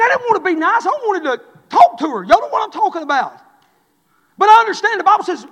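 loud, high preaching cry; full-force preaching. Real, not acted. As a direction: preach it hard, shouting.

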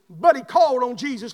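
ordinary talk

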